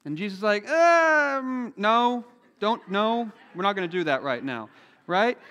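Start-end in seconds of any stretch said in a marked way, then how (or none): 0.7-4.7 high, loud gently amused no; patient refusal. Retold or imitated. Jesus telling disciples not to call down fire, high patient refusal. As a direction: turn down a silly idea kindly.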